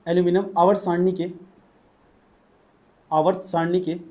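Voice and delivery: short phrases with long pauses for writing; dictation. Real, not acted. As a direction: dictate it piece by piece to a class. Dictates notes to the class. (श्रुतलेख की तरह रुक-रुक कर बोलते हुए)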